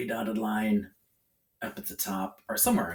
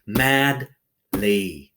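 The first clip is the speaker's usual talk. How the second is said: pounded-out syllables; 7 dB louder than usual. Shown as a demonstration. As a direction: MADLY split into two loud beats, MAD, LEE. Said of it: "Pound it".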